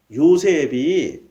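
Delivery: ordinary delivery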